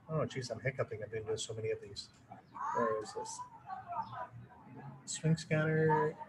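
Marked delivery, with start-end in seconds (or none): 2.7-6.2 quiet distracted mutter under his breath; muttering to himself. Real, not acted. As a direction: mumble it to yourself while searching. Searching his screen, muttering aside.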